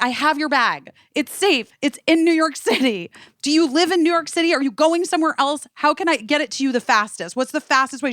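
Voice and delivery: high-pitched, anxious rush to help; acted frantic reassurance, pitched well up; urgent reassurance. Urgently reassuring the crying bag owner.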